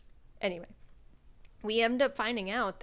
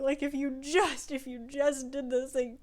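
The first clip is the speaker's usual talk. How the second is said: ordinary delivery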